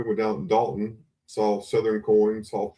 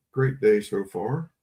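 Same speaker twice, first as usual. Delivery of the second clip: ordinary talk, nothing marked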